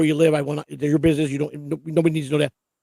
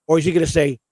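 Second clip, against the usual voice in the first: ordinary talk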